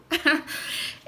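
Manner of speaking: short unvoiced laugh through rising tears; a small choked-up laugh; emotional laugh. Real, not acted. Laughing off her tears on stage.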